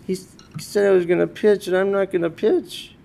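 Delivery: a hint of a hurt boy; imitating crying son. Son telling dad he won't get to pitch, mildly tearful. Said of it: "And he's crying".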